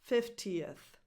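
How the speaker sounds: "fiftieth" in three syllables, fif-tee-uth; extra schwa. Shown as a demonstration. Teacher modelling the ordinal of fifty, "fiftieth" with extra schwa. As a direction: add a clear schwa before the TH in "fiftieth". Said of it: "a little extra sound, a little extra schwa".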